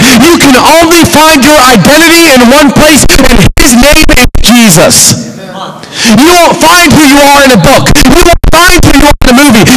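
fiery youth-night preacher; preaching. Acted. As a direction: preach it hard to the room.

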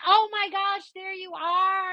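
high, gushing friend voice; excited greeting. Retold or imitated. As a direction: greet a long-lost friend. Friend finds her, retold.

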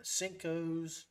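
ordinary talk

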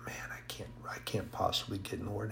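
a fast, unvoiced whisper of self-doubt; despairing whisper. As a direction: whisper it to yourself, ashamed. His despairing inner thoughts.

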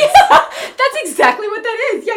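high, loud, laughing yeah; laughing agreement. Real, not acted. Co-host hears the guilt trip story, laughing hard.

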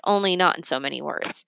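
ordinary delivery

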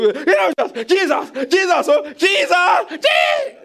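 shrieked, very high pleas climbing to a squeal; mock panicked praying. Preacher mimicking a frightened believer, escalating mock panic. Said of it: "Calm down".

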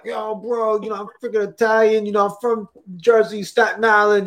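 put-on playful Jersey-style Italian-American accent; New York Italian-American. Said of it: "super New York Italian American".

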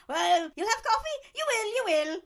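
loud, high, pushy mock invitation; hammed-up excitable offer; over-eager host. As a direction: insist with manic hospitality. Acts out bipolar man's sudden hospitality.

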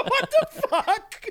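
high laughing what the fuck; laughing disbelief. Real, not acted. Bartender laughing at ravers, high and amused.

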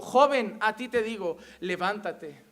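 firm command, falling in pitch at the end; Jesus commanding. Acted. Jesus orders the dead youth to rise, firm command. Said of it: "Y Jesús dijo".

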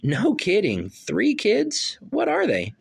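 high, excited disbelief; delighted surprise, pitch way up; amazed. Acted. Amazed at her three kids.

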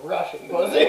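laughing through the words; giggling as he boasts. Real, not acted.

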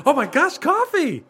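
high, gushing customer voice; excited character voice. Pretend. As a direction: squeal like an overexcited customer. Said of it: "By the way, I don't sound like this".

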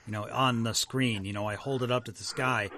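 normal talking voice

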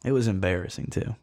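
normal talking voice

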